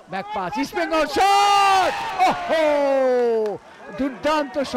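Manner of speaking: shouted, high excited call of a shot; frantic football commentary. Real, not acted. Live goal-chance commentary.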